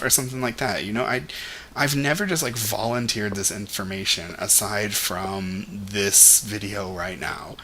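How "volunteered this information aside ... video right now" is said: ordinary talk, nothing marked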